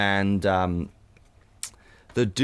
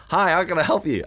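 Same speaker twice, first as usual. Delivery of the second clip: cheerful server; 7 semitones higher than usual. Retold or imitated. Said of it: "he just this huge grin".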